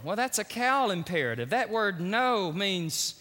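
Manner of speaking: ordinary delivery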